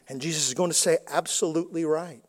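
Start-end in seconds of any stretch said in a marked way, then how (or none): 1.1-2.2 much softer, grave and measured; quiet affirmation. Acted. Jesus answering the self-assured many, soft and grave. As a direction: say it low-key, final and certain.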